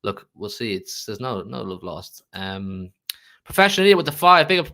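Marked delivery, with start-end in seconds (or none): 3.4-4.7 1 hyped shout-out. Real, not acted. Shouting out a super chat donor.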